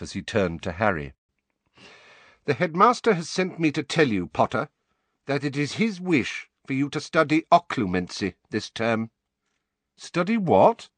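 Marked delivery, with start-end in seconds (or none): 2.4-9.1 high, formal, disdainful teacher; stern Snape. A narrator's reading. Snape passing the headmaster's wish to Harry, disdainful. 10.0-10.9 high, louder baffled question; blank puzzlement. A narrator's reading. Harry asking about an unknown subject, blankly. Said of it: "said Harry blankly".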